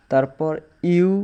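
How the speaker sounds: normal talking voice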